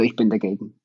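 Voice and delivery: ordinary delivery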